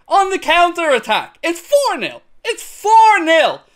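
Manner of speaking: high, loud goal recap; excited. Real, not acted. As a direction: still buzzing from the goal. Still shouting after goal.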